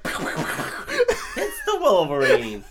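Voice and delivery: slow, loud laughing Southern drawl; mock southern drawl. Friend mocks the villain's greeting in a slow, laughing Southern drawl. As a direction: ham up the drawl, savouring every word.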